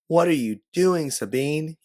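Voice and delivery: high, loud shouted question; scream over noise. A narrator's reading. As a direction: yell it over an explosion. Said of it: "you scream over the sound of an explosion".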